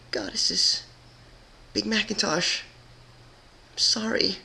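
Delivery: breathy, then high and tearful; tearful apology. Acted. Twilight apologising to Big Macintosh, tearful. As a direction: break into a tearful sorry. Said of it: "let out a small cry".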